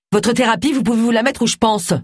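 high-pitched furious retort; indignant, voice held high; angry. Acted. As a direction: fling the insult as you leave. Angry client storms out, insulting therapist.

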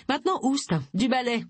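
shooing them out. A narrator's reading. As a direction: chase the visitors off the doorstep. Tilly shoos them out.

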